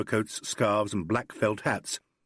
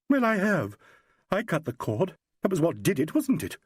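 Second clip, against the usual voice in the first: put-on higher, desperate young woman's voice; distraught young actress; 7 semitones higher than usual. A narrator's reading. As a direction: plead for an answer she fears. Carolyn voiced by narrator.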